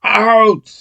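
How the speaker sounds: loud, high-pitched tennis call; yell. Retold or imitated. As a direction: belt it out like a loudmouth on court. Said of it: "I would yell".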